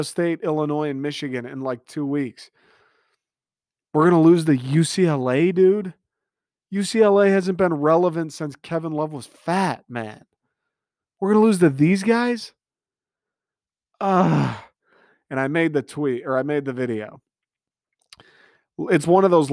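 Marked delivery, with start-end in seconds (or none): 3.9-14.6 higher-pitched indignant rant; incredulous at losing to them; exasperated disbelief. Real, not acted. Fan ranting about upset loss.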